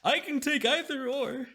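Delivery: high, loud, oily merchant; scheming seller, eager for gold; sly mysterious shopkeeper. Acted. Shopkeeper voice held.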